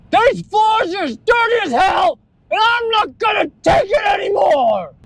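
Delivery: high yelled complaint out the window; hammed-up shout from a movie speech; mock shouted rant. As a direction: yell it like you've had enough. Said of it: "that speech from Stanley Spadowski".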